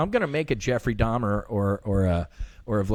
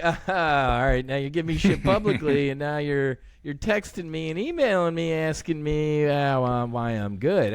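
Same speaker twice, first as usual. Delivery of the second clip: higher, half-laughing mock protest; mock indignant reply; 4 semitones higher than usual. Teasing back at his friend, mock indignant. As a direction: play it as a mock-offended friend. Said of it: "I'm like".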